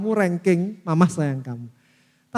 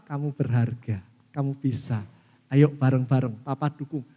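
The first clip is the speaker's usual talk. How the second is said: gentle encouragement; 6 semitones lower than usual. Acted. Father encouraging his child instead of blaming, gentle lower voice. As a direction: lift them up gently.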